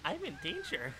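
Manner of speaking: mock character voice. Quoting a cartoon line.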